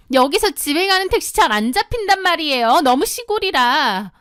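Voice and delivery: put-on high, complaining young woman; whiny sister-in-law. Sister-in-law whining she can't catch a taxi. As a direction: whine that no taxi comes.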